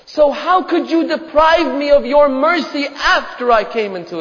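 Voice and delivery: preacher's fervent pleading prayer; impassioned supplication. Acted. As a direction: implore God from the pulpit, voice lifted. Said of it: "The Imam says to Allah".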